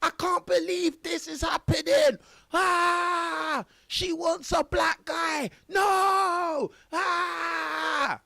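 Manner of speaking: mock anguished yelling, high-pitched; Piers Morgan losing it. Mocking Piers Morgan punching the wall, hammed-up anguished cries. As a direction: ham up a distraught man crying out. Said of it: "Piers Morgan was punching the wall".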